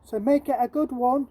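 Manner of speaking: plead, it's the last shilling; a poor boy begging the shopkeeper; Jim's voice. Acted. Jim explains to Mrs Hodder.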